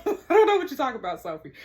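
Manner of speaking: fast, loud, laughing; loud, fast giggling protest. Real, not acted. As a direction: laugh through the confusion. Laughing at confusing drill explanation.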